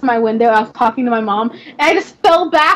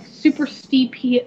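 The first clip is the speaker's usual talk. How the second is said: normal talking voice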